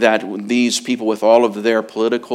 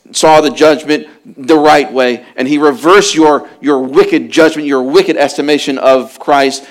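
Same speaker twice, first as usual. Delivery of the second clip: louder, driving preacher's cadence; preaching at full force; 4 semitones higher, 7 dB louder than usual. Acted. Preacher confronting the crowd with Peter's words, full force. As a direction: press the charge on the listeners.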